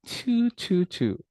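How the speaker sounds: high, puckered "Chew" copying the student; imitating cute chew. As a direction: mimic her cute kissy "Chew". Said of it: "she did it so cutely".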